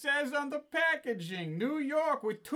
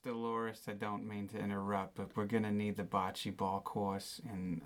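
a much lower, subdued voice throughout; deep-voiced staffer; 13 semitones lower, 4 dB quieter than usual. Staff member interrupting the ladies about the bocce court, low and subdued. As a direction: keep it low and apologetic.

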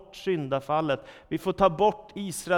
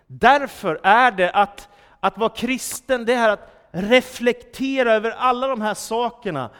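louder, higher fervent sermon build; preaching; 5 semitones higher, 7 dB louder than usual. Acted. Preacher urging the church to reflect, loud and fervent. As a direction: press each question on the congregation.

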